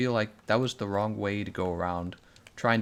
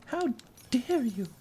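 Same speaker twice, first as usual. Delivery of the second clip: much higher, slow shrill scolding; mock admonishing scold; 14 semitones higher, slower than usual. Streamer repeating the activist's scolding line, high and slow. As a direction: drag the scold out in a piercing voice. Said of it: "very admonishing tone".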